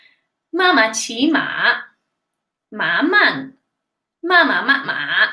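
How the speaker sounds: slow, careful Mandarin tones on "妈妈骑马， 马慢， 妈妈慢马"; "妈妈骑马， 马慢， 妈妈慢马" with swooping tones and pauses between phrases; tongue twister recital. Shown as a demonstration. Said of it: "let me try that again for you".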